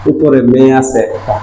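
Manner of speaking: slow and deliberate, phrase by phrase; a teacher's slow recap; slow summary. Shown as a demonstration. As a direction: spell the rule out slowly for the class. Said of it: "এক কথায় বলতে পারি".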